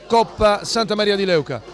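ordinary delivery

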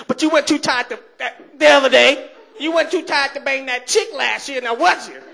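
high-pitched, scolding comeback; mock accusing nag. Woman drawing on the guilt bank, mock accusing. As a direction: throw it back in a high, put-on voice.

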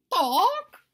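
a high, tiny peeped "Doc"; chick peep. Acted. New chick greeting the world, high peep. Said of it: "And it said".

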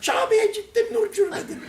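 high, laughing mock pilgrim. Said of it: "Oh diyor". (hacı taklidi, tiz ve gülerek)

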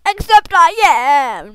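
loud, drawn-out fake bawling; mock crying. Player admits to crying after saying men don't, mock crying. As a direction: bawl it out in a hammed-up cry. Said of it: "Men don't cry".

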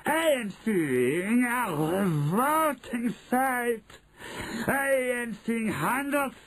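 high shrieking witch voice; shrill screeching Grand High Witch. Acted.

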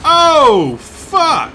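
high, very loud startled outburst; startled yell. Real, not acted. Player caught off guard in the game, startled yell. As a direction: yell it out in sudden shock.

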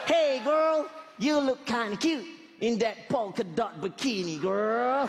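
slow, high American flirt; hammed-up American come-on, stretched out; American slang accent. Shown as a demonstration. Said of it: "there's a American English slang".

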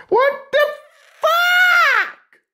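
high shocked yell; shocked shout. Real, not acted.

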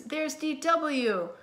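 put-on high, drawn-out child's call; shouting. A narrator's reading. Twins spotting the girl they know, calling out. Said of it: "shouted the Tibble twins".

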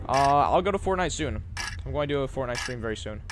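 ordinary talk, nothing marked

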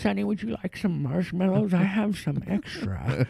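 a lightly voiced old lady, sweet. Host playing an old lady from Bingo offering marshmallows, lightly voiced. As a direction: offer it like a kindly grandma.

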